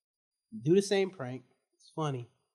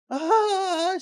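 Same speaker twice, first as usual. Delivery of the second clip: much higher shocked cry; startled gasp; 8 dB louder than usual. Retold or imitated. Student imitating his teacher's shocked cry, mild.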